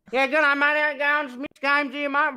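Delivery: loud, high, exaggerated Australian; exaggerated Australian accent. Streamer opening his videos for fans, exaggerated Australian accent. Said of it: "I need to embrace that I'm Australian".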